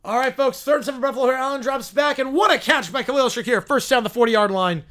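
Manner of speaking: excited sportscaster delivery; football play-by-play. Acted. Streamer calling the Buffalo game, excited play-by-play. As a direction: call the catch like a live commentator.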